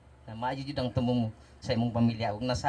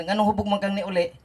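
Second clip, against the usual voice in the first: scolding wife; 6 semitones higher, 7 dB louder, faster than usual. Retold or imitated. Guest voices his wife's scolding.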